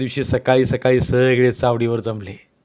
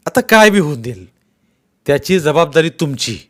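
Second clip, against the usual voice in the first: loud, higher menacing warning; angry threat; 4 semitones higher, 6 dB louder than usual. Acted. Patil warning Bhagwanrao of consequences, angry threat. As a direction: lay the blame on him hard.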